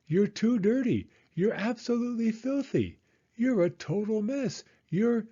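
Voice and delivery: scold, then realise it's your own son; scolding turning to dismay. Acted. Mother scolding a dirty boy, then recognising Michael in high dismay.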